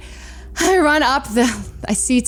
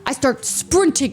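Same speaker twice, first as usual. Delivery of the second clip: ordinary talk, nothing marked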